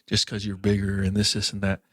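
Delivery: ordinary talk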